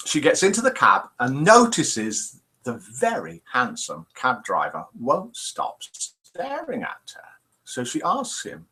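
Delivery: normal talking voice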